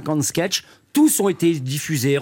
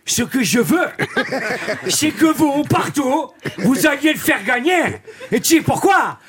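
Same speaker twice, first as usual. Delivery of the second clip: Yvan character voice; 8 semitones higher, slower than usual. Acted. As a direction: shout it like a brash hustler. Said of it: "Yvan, dans La vérité si je mens".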